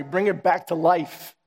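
slow, earnest plea to God; prayerful plea. Acted. Praying for revival, slow and earnest. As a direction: ask slowly, from the heart. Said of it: "Lord, give me that fire".

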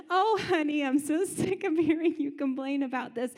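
a hammed-up exasperated spouse; sarcastic wife. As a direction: play the fed-up wife urging murder, half laughing. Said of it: "his wife, who sounds lovely".

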